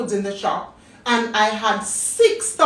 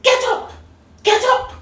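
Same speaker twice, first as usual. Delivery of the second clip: supervisor yelling, much higher and louder; angry shout; 6 dB louder than usual. Retold or imitated. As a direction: bark it out like a furious boss. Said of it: "He says".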